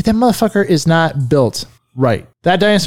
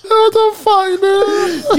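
high, cracking cry of disbelief; shocked disbelief; much slower than usual. Real, not acted. Friend can't believe the other forgot, shocked cry. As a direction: blurt it out stunned.

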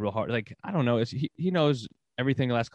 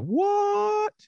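mock disbelief. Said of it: "I'm like".

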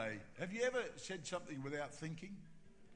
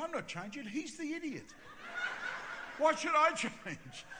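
high-pitched protest, getting louder; indignant protest; 11 semitones higher, 7 dB louder than usual. Retold or imitated. Pastor arguing back with God, high and indignant. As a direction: argue back in mock outrage, climbing to a loud finish.

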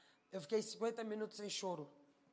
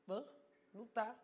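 dismissive confusion; 5 dB quieter than usual. Retold or imitated. Friend looks out the window, dismissive confusion. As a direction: react as if barely bothered. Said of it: "ó, tipo assim".

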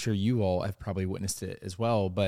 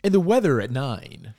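slow, higher, louder weather teaser; news anchor voice; 4 semitones higher, 7 dB louder than usual. Pretend. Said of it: "does that sound like".

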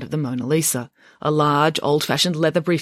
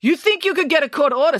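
high, incredulous director; pompous, disbelieving official; scoffing challenge; 12 semitones higher, 4 dB louder than usual. Acted. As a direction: scoff at the threat in outrage.

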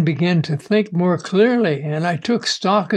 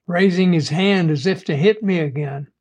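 ordinary delivery

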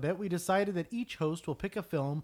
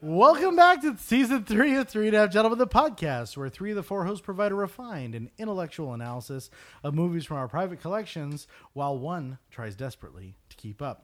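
upbeat show-opening announcer read; lively podcast announcer opening the show; podcast host intro; 5 dB louder than usual. Acted. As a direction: welcome listeners like a radio host.

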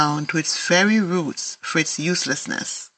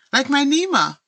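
a bright, higher burst of excitement; higher excited exclamation; excitedly; 8 semitones higher than usual. A narrator's reading. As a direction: jump in eagerly, delighted by the match. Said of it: "Dolphin says excitedly".

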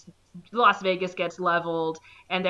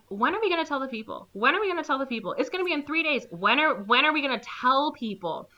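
rapid, higher-pitched acted pestering; insistent nagging; 5 semitones higher, faster than usual. Geologist pressing officials to warn the people, insistent nagging. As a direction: press the same question urgently.

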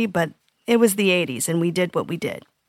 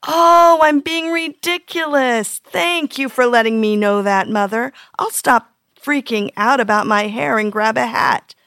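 high, sing-song mock obedience; sarcastic mock kid; 3 semitones higher than usual. Parent mocking an imagined kid thanking mother, sarcastic. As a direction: lay the fake gratitude on thick. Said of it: "Never has there ever been a kid who said".

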